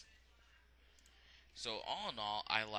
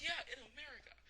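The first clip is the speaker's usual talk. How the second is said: much higher mock Keith; high catchphrase; 3 dB quieter than usual. Keith answering Tristan, high mock voice. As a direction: pitch the catchphrase way up.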